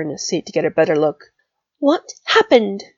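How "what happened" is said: demanding. Acted. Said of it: "Pansy demanded".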